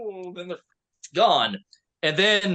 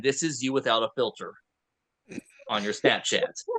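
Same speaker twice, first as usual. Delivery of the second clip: normal talking voice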